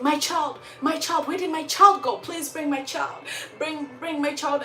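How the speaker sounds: high, pleading mother's cry; distressed mother pleading. Retold or imitated. Mother begging the spirit for her child, high pleading. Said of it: "she's crying and she's crying".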